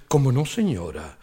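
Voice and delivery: a low, servile "señora"; waiter. Retold or imitated. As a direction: waiter answering the old lady. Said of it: "waiter answers".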